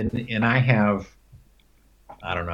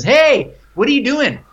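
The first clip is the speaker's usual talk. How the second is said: neighbor's call; 6 dB louder than usual. Retold or imitated. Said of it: "she says".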